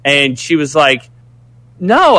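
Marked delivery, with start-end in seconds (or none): 1.8-2.2 mock denial. Imitating woman denying.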